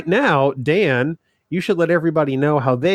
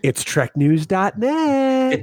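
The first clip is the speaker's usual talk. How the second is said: higher, slow trailer-voice read; mock announcer; 6 semitones higher, much slower than usual. Co-host announcing the news segment in a mock trailer voice.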